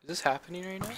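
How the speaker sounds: loud, high disbelief; surprised. Real, not acted. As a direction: blurt it out in shock. Sudden surprise in game.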